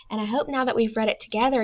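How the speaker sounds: ordinary delivery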